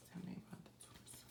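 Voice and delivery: very soft, quick whispery murmur; barely audible, said to yourself. Real, not acted. Reader asking about the torso killer, under the breath. Murmured question during the reading.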